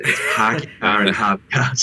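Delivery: laughing Boston accent, dropped r's. Shown as a demonstration. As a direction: show the real Boston accent. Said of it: "that's not Boston".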